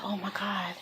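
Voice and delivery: soft, breathless whisper of shock; a mother sees her injured family and whispers in shock; stunned whisper. Retold or imitated. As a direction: barely breathe it out in horror. Seeing family hurt after crash.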